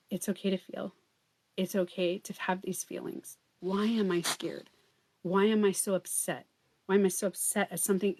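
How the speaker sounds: soft, reassuring inner voice; gentle self-soothing. Acted. Modelling self-talk for someone scared, gently soothing. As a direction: talk yourself down quietly.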